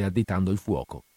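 usual speaking voice

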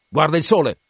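exclaiming. Acted.

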